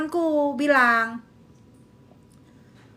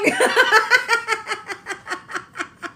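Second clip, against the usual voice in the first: laughing fit; 7 semitones higher than usual. Real, not acted.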